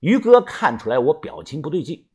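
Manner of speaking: ordinary delivery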